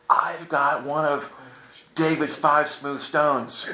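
slow, drawn-out mock solemnity; reverent boast. Pilgrim showing off his rock to Wally, slow mock-reverent boast. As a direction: savor each word, very slowly.

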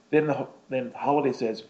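ordinary delivery

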